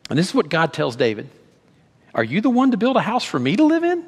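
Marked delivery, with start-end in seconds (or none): 2.1-4.0 high, louder, asking in disbelief; incredulous question. Acted. God asking David in disbelief, incredulous. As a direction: ask it as if the idea is absurd. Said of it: "that's the tone I would ask it".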